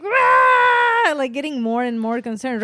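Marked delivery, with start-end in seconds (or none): 0.0-1.1 put-on high-pitched drawn-out baby cry; imitated baby wail. Baby woken at night, imitated loud wail. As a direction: wail like an upset baby, in fun. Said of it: "the baby's like".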